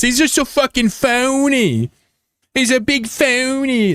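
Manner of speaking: way up high, slow mocking squeal; mock high squeaky voice. Streamer mocking a player in a squeaky voice. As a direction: sneer it in a silly high voice.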